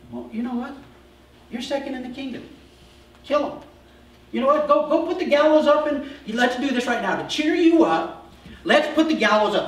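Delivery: high, loud, pushy encouragement; egging him on, all fired up; eager urging. Retold or imitated. Haman's family urging him to build the gallows, high and loud.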